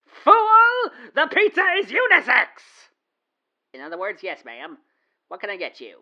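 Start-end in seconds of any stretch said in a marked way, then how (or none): 0.1-2.5 much higher, loud, fast declaration; hammed-up loud indignation; mock outrage. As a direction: proclaim it like a cartoon villain.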